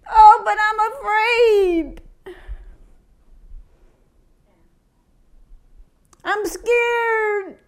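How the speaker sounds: high whimpering mock fright; hammed-up frightened whine; mock fear. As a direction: play the timid scaredy-cat, whining. Mocking a timid believer.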